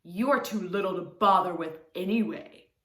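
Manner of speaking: lion voice, slightly stronger; dismissive lion. A narrator's reading. Lion flicking the mouse away, dismissive. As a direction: brush the mouse off. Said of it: "he said".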